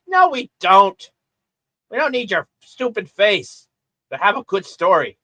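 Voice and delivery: high, loud scolding comeback; exasperated retort. Acted. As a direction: shoot down the vain star, drawing out the first words. Mock retort to vain actor.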